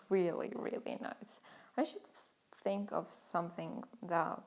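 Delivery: usual speaking voice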